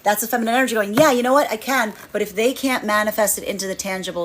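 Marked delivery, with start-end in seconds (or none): 0.9-1.9 higher and louder, bursting with yes; lifted, louder eager yes; sudden confidence. Acted. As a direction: realise you can and say it brightly. Feminine energy gaining confidence.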